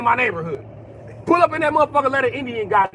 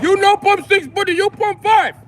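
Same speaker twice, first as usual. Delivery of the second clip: put-on much higher, louder Indian accent, slow and insistent; 11 semitones higher, 5 dB louder than usual. Indian cashier correcting the customer's pump, Indian accent. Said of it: "they'll argue with you too, like".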